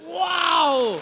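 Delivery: shouted, drawn-out wow; astonished wow. Retold or imitated. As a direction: burst out in loud amazement at the miracle. Preacher's amazed reaction to angels.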